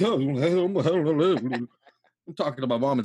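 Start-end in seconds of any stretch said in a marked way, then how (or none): 0.0-1.7 put-on drunk mumble. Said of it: "I'm like".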